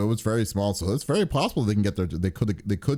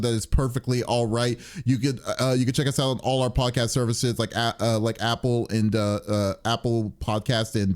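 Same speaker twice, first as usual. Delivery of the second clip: ordinary talk, nothing marked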